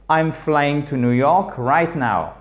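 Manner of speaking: slow, with pitch lifted on "right" before "now" falls; fall-rise emphatic intonation. Shown as a demonstration. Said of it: "Montante pour insister sur un élément". (insistance mélodique et lente sur « right now »)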